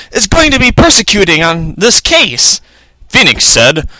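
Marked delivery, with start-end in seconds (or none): 0.1-2.6 high-pitched character voice; Maya. A narrator's reading. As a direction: carry on in the sidekick's raised voice. Narrator voicing Maya.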